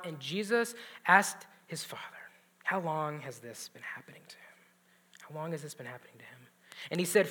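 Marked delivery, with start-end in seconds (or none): 2.6-6.4 gentle whisper. Retold or imitated. Preacher whispering Jesus' question to the father. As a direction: whisper the question softly, tender and low.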